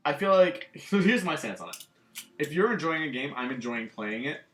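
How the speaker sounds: normal talking voice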